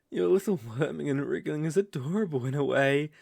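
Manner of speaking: ordinary delivery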